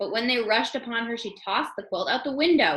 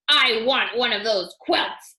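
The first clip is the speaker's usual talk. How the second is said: mild demand; 6 dB louder, slower than usual. Acted. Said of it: "the king demanded".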